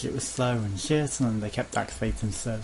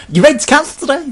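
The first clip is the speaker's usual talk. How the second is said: squeaky high, loud sales pitch; cheery pushy salesperson; 14 dB louder, faster than usual. Retold or imitated. Sky agent pushing cancellation, high cheery salesperson voice. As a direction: ham up an eager agent rushing him through.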